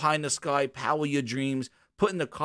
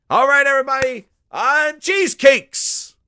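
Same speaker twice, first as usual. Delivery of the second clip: much higher and louder, slowed, a mock showman unveiling; grand announcement; 11 semitones higher, 10 dB louder than usual. [name] presenting cheesecakes to the crowd, hammed-up loud reveal.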